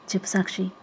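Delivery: weary soft reply; softly. Acted. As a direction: answer the phone barely above a murmur. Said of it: "మెల్లిగా అంది".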